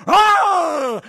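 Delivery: very high, loud imitated cub cry; little bear's holler. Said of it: "while the little bear was going".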